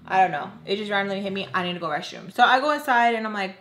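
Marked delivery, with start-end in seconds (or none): none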